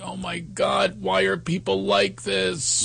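a hint of stunned despair; hushed dismay. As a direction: breathe it out in shock. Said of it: "And you just think".

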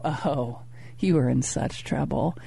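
mock menacing murmur. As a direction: tease her with a hushed mock warning. Playful threat to wife in story.

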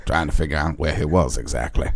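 Morgan Freeman impression. Morgan Freeman-style narration.

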